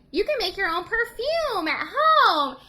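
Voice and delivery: high, drawn-out sales pitch with a laugh; imitated toy slogan. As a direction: sing out the product slogan, slow and high. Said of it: "and it said, like".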